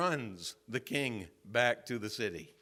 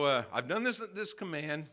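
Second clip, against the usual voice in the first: ordinary delivery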